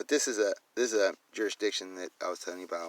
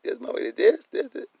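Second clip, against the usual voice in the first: much higher and louder, reporting in a rush; frantic caller; 10 dB louder than usual. Retold or imitated. Retelling his 911 calls, playing himself as a frantic caller. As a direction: blurt it out like a worked-up caller. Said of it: "I be like".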